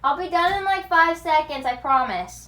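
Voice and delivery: loud, bursting with news; excited plea. Real, not acted. Begging viewers to stay for her big news, loud and thrilled. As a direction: rush the promise out, thrilled.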